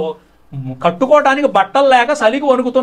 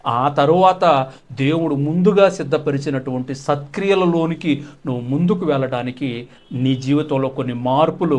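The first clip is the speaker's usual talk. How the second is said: usual speaking voice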